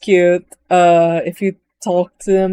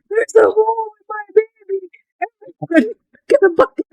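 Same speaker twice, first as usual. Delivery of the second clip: much higher, hammed-up crying wail over the ship; mock wailing; 14 semitones higher, faster than usual. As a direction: fake sob, then rush the order. Voicing the captain over leaking ship.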